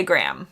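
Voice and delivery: Graham as one syllable, gram; American Graham. Shown as a demonstration. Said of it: "But in America, we say".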